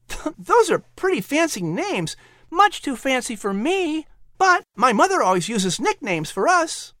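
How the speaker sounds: put-on high, eager little boy pleading; a hopeful child asking his mother; boy begging to keep his pets; young boy voice. Patrick replies to courier.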